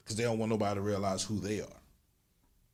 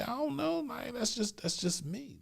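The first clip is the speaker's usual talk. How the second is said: high-pitched shrug; 13 semitones higher, 3 dB quieter than usual. Retold or imitated. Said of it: "And I say".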